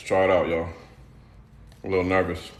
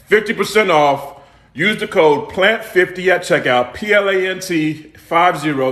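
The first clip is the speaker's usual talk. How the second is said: promo announcer; 6 semitones higher, slower than usual. Acted. Announces discount code.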